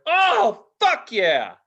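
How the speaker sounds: high, loud whoop dropping into the curse; thrilled yell. Retold or imitated. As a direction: re-enact the rush of a drop, yelling. Acts out his thrill while falling.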